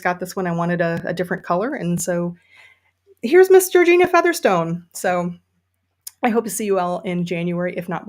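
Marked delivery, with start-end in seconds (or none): 3.2-4.9 higher, louder showman's reveal of Miss Georgina Featherstone; grand announcement. Real, not acted. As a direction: present the hat like a star on stage. Grand introduction of new hat.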